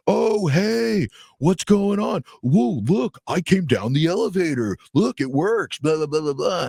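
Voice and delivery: high, singsong taunting; mocking gloat. Drunk fan shows off that the elevator works, mocking gloat.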